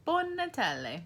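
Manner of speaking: Buon Natale sung out high and bright in Italian; Italian greeting. Shown as a demonstration. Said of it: "we're gonna say".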